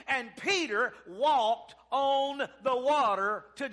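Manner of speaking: rousing revival exhortation; full-force preaching. Real, not acted. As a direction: preach it hard, stretching key words.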